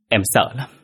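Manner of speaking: scared wife confiding in her husband; a touch of tearful fear; frightened. A narrator's reading. (sợ hãi)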